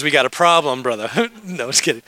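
usual speaking voice

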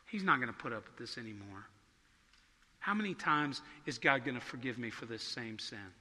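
low, subdued talking to oneself; private self-talk. Acted. Preacher acts out a sinner's talk with himself, low and subdued. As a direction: voice dropped, as if God can't hear. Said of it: "conversation with ourselves as if God can't".

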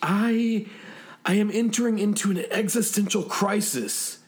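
high, wavering despairing voice; tearful pilot. Acted. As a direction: play a pilot close to tears, pitched high. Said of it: "He was secreting tears".

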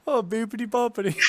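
hammed-up fake Italian voice; mock Italian gibberish, high and bright; fake Italian accent. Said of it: "nailed it".

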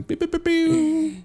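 high, slow droid beeps; robotic beeping. Pretend. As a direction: beep like a droid. Sound effect imitation.

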